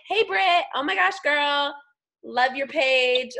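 put-on high, loud girly enthusiasm; gushing greeting. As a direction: gush like a hyped-up friend in a voice message. Acting out her own enthusiastic message.

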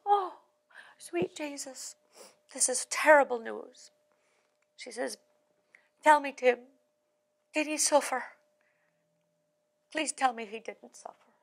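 hammed-up Irish wife lamenting; Irish accent. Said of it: "I don't do an accent very well".

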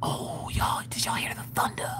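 hushed, soft whisper. Pretend. Asking viewers if they heard thunder, whispered. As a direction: whisper it to the room.

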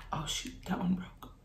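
whispered under the breath; a hushed little aside; whispering. Real, not acted. Muttering while the food breaks.

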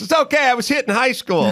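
high, bright mimic of the reporter; chirpy high imitation; mimicking her. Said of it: "She goes".

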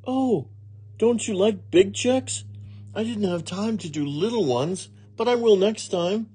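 high, innocent maid's reassurance; Amelia Bedelia promising. A narrator's reading. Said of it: "promised Amelia Bedelia".

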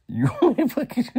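high, laughing, can't finish the word; dissolving into laughter. Retold or imitated. Retelling his jab at the cellmate, breaking into high laughter. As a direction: let the laugh take over the line.

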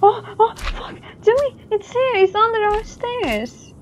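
real fright, high frantic yelling; startled by a slime, cry out; jump scared. Real, not acted. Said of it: "fucking jump scared me".

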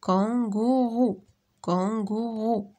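Kangourou stretched slowly into three even beats; syllable by syllable. Shown as a demonstration. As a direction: draw out each syllable of Kangourou. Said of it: "Frappe les syllabes dans tes mains".

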